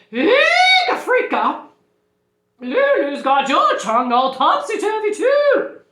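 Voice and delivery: very high, loud squeal of alarm; high-pitched storybook panic; alarmed teacher. Acted. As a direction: shriek it out in comic horror. Teacher exclaims in alarm at principal.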